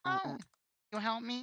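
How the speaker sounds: put-on high, small frightened child; scared kid voice. Kid asks the rug seller to walk him home, high and frightened. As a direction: play a scared little kid begging for help.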